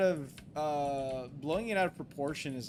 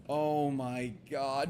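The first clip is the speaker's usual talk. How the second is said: ordinary delivery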